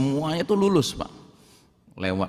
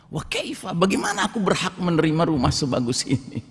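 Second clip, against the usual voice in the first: softer, high stunned question; startled disbelief; 5 semitones higher, 4 dB quieter than usual. Acted. Servant told the palace is his, hushed astonishment. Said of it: "Kaget dia".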